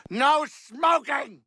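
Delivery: high stretched-out shout, slow; shouting no smoking. Real, not acted. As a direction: bellow it across the room. Catches someone smoking in saloon.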